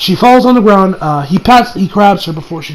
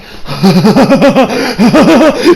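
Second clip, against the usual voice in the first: villain laugh; 2 semitones higher than usual. Retold or imitated. Villain cackles over his captured prize, maniacal laugh. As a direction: cackle like a gleeful madman. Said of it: "the dude is just sitting there like".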